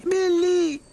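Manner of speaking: calling out loud and high from afar; high ringing call of a name; distant shout. A narrator's reading. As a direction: shout his name across the crowd. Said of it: "someone was shouting".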